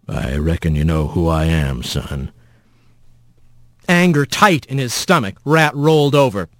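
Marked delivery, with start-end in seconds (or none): 0.1-2.3 low, soft rough rumble. A narrator's reading. Mysterious voice in the dark addresses Rat, soft and rough. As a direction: speak low and rough, like sandy soil. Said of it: "a voice as soft and rough".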